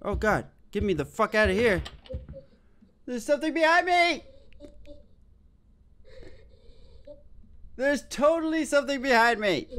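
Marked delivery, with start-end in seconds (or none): 3.1-9.7 high, alarmed frightened voice. Real, not acted. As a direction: blurt it out in panic. Scared in dark horror game.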